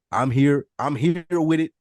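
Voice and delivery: ordinary delivery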